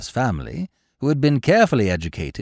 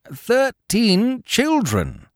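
much higher, slow shouted retort; much slower than usual. A narrator's reading. Genius answers the Baron with a high slow shout. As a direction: hurl it as the final blow. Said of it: "shouted the genius".

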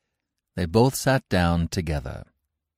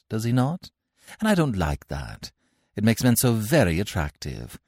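higher, gracious society lady; a hint of a gushing Victorian lady; lightly voiced haughty lady; 6 semitones higher, 4 dB louder than usual. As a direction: play a grand lady being charming. Gwendolen voiced in read play.

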